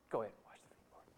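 hushed, whispered surrender; resigned little whisper; meek whisper. Acted. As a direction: give in under your breath. Imagined meek giving-in to Jesus.

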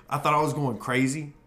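ordinary talk

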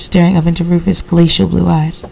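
plain reading voice

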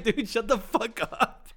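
slow, exaggerated deadpan brush-off; mock exasperated comeback; fed-up retort. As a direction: drag it out like you're done with him. Telling off the fawning driver.

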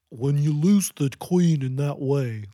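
lightly voiced goofy old man, slow; slow goofy male voice. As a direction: drag it out like a goofy old guy. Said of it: "she was talking like that".

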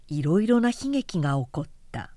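ordinary delivery, nothing marked

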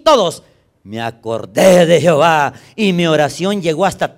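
lectura bíblica fuerte y alta, estirando frases; predicación a toda voz. Real, not acted. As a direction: proclama el versículo con fuego. Predica leyendo la Biblia fuerte. (pastor a la congregación, predicando a gritos)

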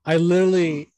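can't wait to tell it; excited burst. Real, not acted.

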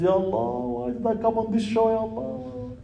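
high, hushed plaintive moan to himself; squirming sheikh's private lament; embarrassed sheikh. Acted. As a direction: mutter a dismayed prayer, high and very soft. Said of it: "He blushes".

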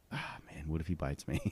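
scared whisper. Retold or imitated. Hiding in the bathroom from the dog, as a scared whisper. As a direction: whisper it as if the dog might hear.

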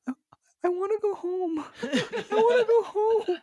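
put-on high, whimpering little boy wanting home; high-pitched whiny plea to go home; panicked child. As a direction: imitate a scared kid near tears, high. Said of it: "he just started having this panic attack".

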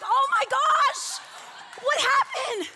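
hammed-up shocked viewer, laughing; mock shock. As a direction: gasp it like a hooked novela fan. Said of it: "and you're like".

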